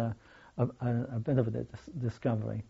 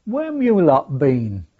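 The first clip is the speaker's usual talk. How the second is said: loud retold Birmingham accent call; broad Brummie, loud and cheery; Brummy accent; 2 semitones higher, 9 dB louder than usual. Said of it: "in the broadest Brummy accent".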